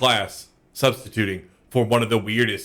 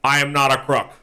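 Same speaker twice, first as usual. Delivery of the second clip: Nixon impression; 3 semitones higher than usual. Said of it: "That's my Nixon impression".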